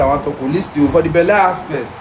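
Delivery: normal talking voice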